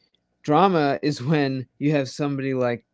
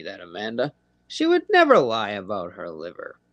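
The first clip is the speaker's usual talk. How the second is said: sarcastic mock gullible. Host mocking a newcomer fooled by Amanda, sarcastic. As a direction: play the naive fool to ridicule her.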